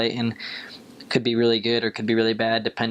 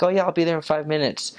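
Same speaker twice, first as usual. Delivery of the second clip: lightly mimicked, higher breezy promise; breezy late excuse; 6 semitones higher than usual. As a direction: toss off the excuse as if nothing's wrong.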